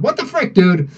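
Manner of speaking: high surprised outburst; sudden what-the-heck; real surprise at a vanished message. Real, not acted.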